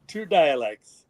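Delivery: usual speaking voice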